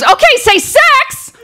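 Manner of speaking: high, drawn-out chirpy cue; mock-cheerful photographer; perky photographer. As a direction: call out the pose cue in a very high bubbly voice. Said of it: "She goes".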